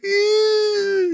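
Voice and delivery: long squealed mock sob, very high; high-pitched fake crying. Recapping how Martin bursts into tears, high-pitched fake crying. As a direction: imitate a comic high-pitched whine of crying. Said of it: "high-pitched voice and, like, fake crying".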